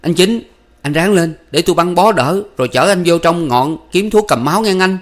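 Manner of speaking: high, loud, anxious urging; urgent calling. A narrator's reading. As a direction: urge a wounded friend to hold on. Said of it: "lai gọi Chính Thuộc".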